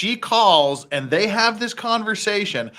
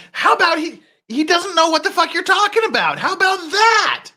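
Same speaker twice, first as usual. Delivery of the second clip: pitched way up, mimicking an outraged denial; mock high-pitched reply; 12 semitones higher than usual. Reacting streamer mocks the suspect's denial, high mock voice.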